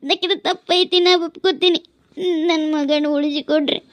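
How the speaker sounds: high-pitched sobbing plea; tearful pleading. Acted. Mother begging forgiveness for daughter. (ಅಳುತ್ತಾ ಅಂಗಲಾಚುವ ಧ್ವನಿ)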